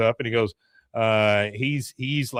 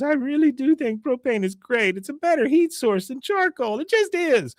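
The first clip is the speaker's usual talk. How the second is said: high-pitched mock tearful wail; imitated crying niece; 13 semitones higher than usual. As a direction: wail it high like a weeping girl. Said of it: "like, crying".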